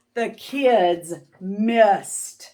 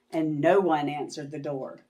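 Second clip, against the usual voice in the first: plain reading voice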